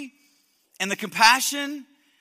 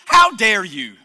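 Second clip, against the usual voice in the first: loud outraged shout; indignant shout; 2 semitones lower, 10 dB louder than usual. Acted. Jonah shouting at God, furious and outraged. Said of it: "look at his response to God".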